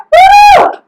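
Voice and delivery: loud high whoop; excited cheer. Real, not acted. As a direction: whoop to pump up the class. Cheering on workout class.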